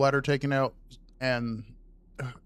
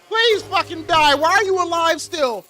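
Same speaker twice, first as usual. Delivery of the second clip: high, loud angry shout; exasperated yell; 11 semitones higher, 7 dB louder than usual. Real, not acted. Streamer fighting an unkillable game enemy, angry shouting. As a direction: yell at an enemy that won't die.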